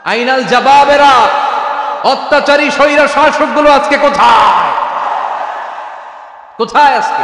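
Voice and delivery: loud, high preacher cry; fiery sermon shout; thundering question. Acted. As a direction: hurl the question at tyrants.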